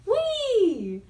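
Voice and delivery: a high, thrilled whee. A narrator's reading. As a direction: squeal it sliding downhill. Flying off the bump.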